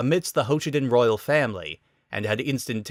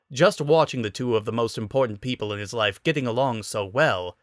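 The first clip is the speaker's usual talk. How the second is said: usual speaking voice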